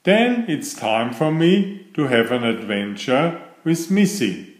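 plain reading voice

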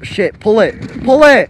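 loud high panicked yell; panicked shouting. Real, not acted. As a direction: yell it as you start to slide. Trolley slipping downhill.

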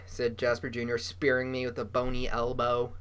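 ordinary delivery, nothing marked

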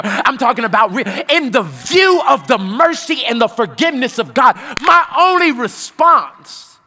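loud, climbing preacher's cry; preaching at full force. Acted. Pastor testifying to his congregation, preaching at full force. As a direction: preach it out, building to a roar.

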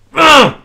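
high, loud wordless squeal of joy; real elated scream; excited shriek. Real, not acted. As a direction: let out a shrill happy squeal. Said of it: "Anteeksi, mä huudan".

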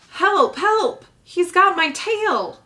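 high, loud panicked cries; screaming for help. Acted. Young beaver shrieking for help from the pond. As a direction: scream it like something has your tail.

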